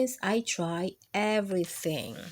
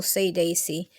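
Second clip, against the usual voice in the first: plain reading voice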